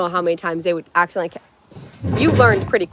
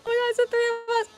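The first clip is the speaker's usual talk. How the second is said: much higher, fast, squealing delight; excited squeal; 3 dB quieter, faster than usual. Retold or imitated. Vlogger retelling her stop-sign meme moment, squealing excitedly. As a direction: squeal it like you can't believe your luck.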